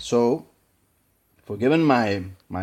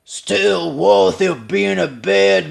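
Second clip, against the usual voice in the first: imitating Pacino; 3 semitones higher, 4 dB louder, slower than usual. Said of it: "Pacino says".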